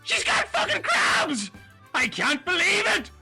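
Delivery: ordinary delivery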